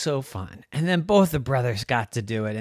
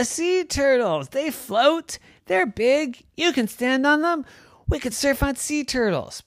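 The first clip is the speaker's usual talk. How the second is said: put-on high, loud thrilled kid voice; excited kids; 5 dB louder than usual. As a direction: bubble over with delight. Kids excited about turtles.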